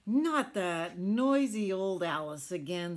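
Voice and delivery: loud, fed-up groan of a line; big ships grumbling loudly; annoyed big ships. Acted. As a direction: complain loudly like bossy ships. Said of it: "said all the big ships".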